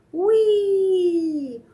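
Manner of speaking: high loud gleeful wee; slide wee. Pretend. Parent on the slide with toddler, gleeful high wee. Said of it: "going down the slide".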